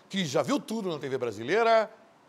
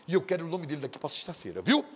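ordinary talk, nothing marked

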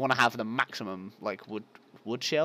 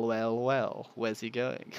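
normal talking voice

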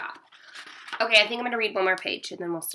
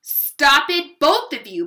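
loud, raised motherly reprimand; scolding; 4 semitones higher, 7 dB louder than usual. A narrator's reading. Mom breaks up brother and sister, scolding. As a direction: scold two squabbling children. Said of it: "Mom scolded".

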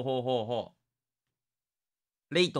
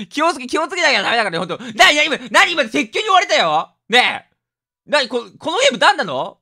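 1 startled fuss; 6 semitones higher, 7 dB louder than usual. Real, not acted. Startled by scream in game.

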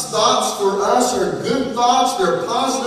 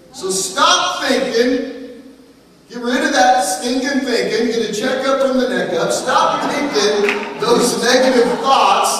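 rising, fervent pulpit preaching, higher and louder; preaching at full force; 4 semitones higher, 4 dB louder than usual. Acted. Preacher urging the congregation to drop negative thoughts, fervent preaching. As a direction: drive it home like a revival preacher.

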